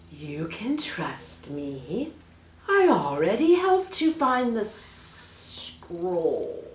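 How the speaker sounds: slow, drawn-out snake hiss. Acted. Snake coaxes the children, slow hiss. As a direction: coax them slowly like a serpent. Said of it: "hissed the snake".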